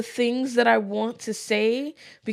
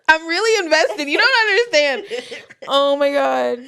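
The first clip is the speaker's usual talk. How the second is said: laughing through tears; teary laughter; 6 semitones higher, slower than usual. Real, not acted. Listener moved by the story, laughing through tears. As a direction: laugh while eyes water. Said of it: "She literally has wet eyes".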